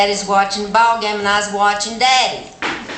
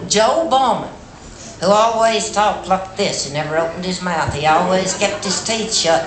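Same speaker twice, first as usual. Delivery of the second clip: ordinary delivery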